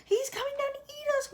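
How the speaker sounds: high, quavering wail; old grasshopper wailing. Acted. Old grasshopper wailing that the Cloudman will eat them. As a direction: wail it like a terrified old insect. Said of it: "wailed the old green grasshopper".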